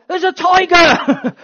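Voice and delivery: high, loud, slow alarmed cry; alarmed warning shout. Shown as a demonstration. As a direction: warn them of a tiger, loudly. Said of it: "You know".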